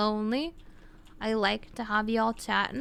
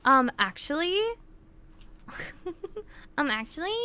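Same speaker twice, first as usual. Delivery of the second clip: mock know-it-all nerd interjection; hammed-up nerd voice, ending on a rise; nerdy "um, actually"; 5 semitones higher than usual. Shown as a demonstration. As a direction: correct them like a pedantic nerd. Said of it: "nasal voice is just nerdy".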